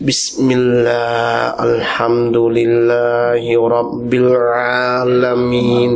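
low, drawn-out monotone chant; slow droning prayer recitation. Acted. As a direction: imitate a worshipper dragging every syllable. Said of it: "อ่านช้ามากเลย".

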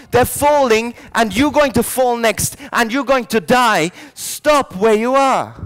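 loud, high-pitched urgent shouting; shouting a warning. Acted. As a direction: shout it at a crowd heading for danger. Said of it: "And he's shouting".

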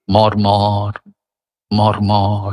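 মর্মর low and evenly drawn out; soft low glide. Shown as a demonstration. Reciter showing wind through leaves, soft gliding মর্মর. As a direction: let মর্মর roll gently in a low register, no stress. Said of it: "কোমল পর্দা আছে".

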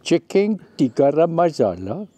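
chicken tikka masala in a put-on Indian accent. Wife repeating the dish in an Indian accent on request. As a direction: lean into an Indian accent, rolling the words. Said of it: "Do, do that accent again".

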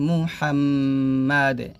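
মুহাম্মাদে with a bounced final d; slow মুহাম্মাদে ending on a springy d; qalqalah at pause. Shown as a demonstration. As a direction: hold the m, then echo the d in মুহাম্মাদে. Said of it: "দাল সাকিন হবে এবং কলকল হবে".

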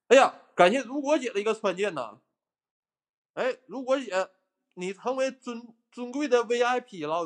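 拔高嗓子夸张地感谢打赏; 像主播捧场一样高声道谢; 热情致谢. Real, not acted. 主播热情感谢打赏观众. (高亢热情地吹捧贵宾)

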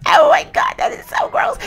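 very high-pitched grossed-out cry; disgusted squeal. Real, not acted. Player sees rats and roaches, disgusted squeal. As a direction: squeal it, utterly revolted.

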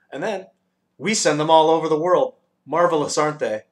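high, loud, proud guide; enthusiastic showing off. A narrator's reading. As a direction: show off the wonders eagerly.

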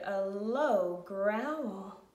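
slow, low, loud drawn-out words; low growl. A narrator's reading. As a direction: stretch it out low and menacing. Narrator mimics the low growl heard.

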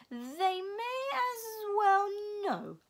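put-on slow otherworldly old voice; Missus Which voice. A narrator's reading. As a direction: drag each word out like an ancient being. Missus Which speaks in story.

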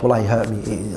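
normal talking voice

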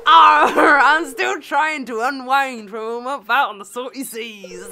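loud drawn-out growl into pirate talk. Acted. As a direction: play an old pirate, breaking into laughter. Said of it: "pirate voice for Mister Willy now".

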